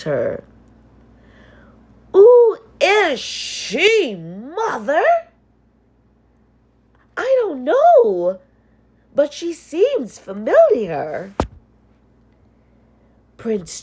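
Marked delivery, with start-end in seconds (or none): 2.1-5.0 put-on high, slow, haughty girl's voice; a spoiled stepsister asking; stepsister's voice. Stepsister asks her mother about the stranger, high and haughty. 7.2-11.3 high, puzzled stepmother voice; a stepmother who can't place her; stepmother's voice. Acted. Stepmother answers her daughter, high and puzzled.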